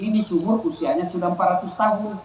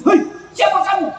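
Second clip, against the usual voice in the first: startled shout; 9 semitones higher than usual. Retold or imitated. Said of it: "Kaget suami".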